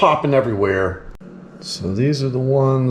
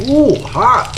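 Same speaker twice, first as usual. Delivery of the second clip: hot yelp; 13 semitones higher, 4 dB louder than usual. Real, not acted. Grabbing a hot crab cake.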